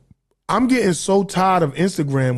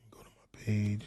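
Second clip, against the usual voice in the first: barely voiced, very soft mumble; muttering to self; 10 semitones lower, 16 dB quieter than usual. Real, not acted. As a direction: talk under your breath while searching. Mumbling to self while searching.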